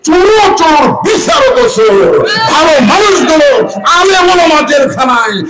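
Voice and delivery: high, shouted sermon outcry; full-force preaching. Real, not acted. (উঁচু গলায় জ্বালাময়ী ওয়াজ)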